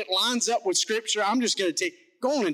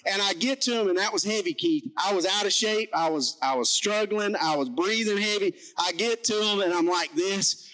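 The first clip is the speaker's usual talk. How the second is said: ordinary talk, nothing marked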